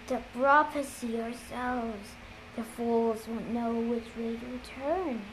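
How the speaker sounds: slow, soft, husky voice; husky and hushed, taking its time; slow husky imitation, kept quiet; Luke. A narrator's reading. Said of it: "becoming a husky approximation to Luke's".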